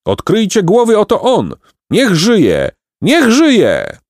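high, jubilant cheering; triumphant rallying cheers; crowd acclamation. A narrator's reading. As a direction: hail the new ruler in high cries. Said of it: "zakrzyknięto w tłumie".

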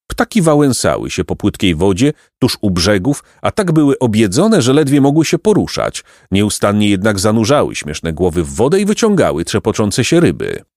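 ordinary delivery

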